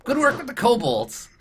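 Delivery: pleased goblin lawyer. Acted. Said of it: "it's like".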